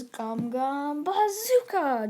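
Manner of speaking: long drawn-out call, pitch swooping up high; mock battle cry stretched out on the last word; attack call. Deku winds his hand back, calls out his attack.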